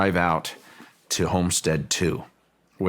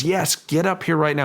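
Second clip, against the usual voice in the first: much higher, insistent mock shout; retold urgent call; 10 semitones higher than usual. Physicist summoning the owner up the mesa, retold urgent shout. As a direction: imitate an urgent summons without full volume.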